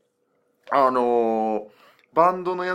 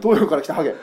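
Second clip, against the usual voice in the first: normal talking voice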